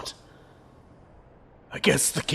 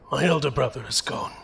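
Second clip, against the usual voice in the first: ordinary talk, nothing marked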